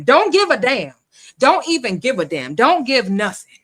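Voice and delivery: loud, punched scornful repetition; emphatic scorn. Real, not acted. Host ranting about beggars who never give, scornful. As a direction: hammer each "don't" hard.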